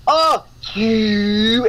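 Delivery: put-on long fronted "you" in a Baltimore accent; mock Baltimore accent. Co-host mimicking the sound that gets to her, Baltimore accent. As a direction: stretch the "you" like someone from Baltimore. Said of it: "because of the way that "ooh" sound".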